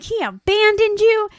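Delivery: retold indignant accusation; super angry. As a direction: throw it out in acted anger. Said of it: "Grace is super angry".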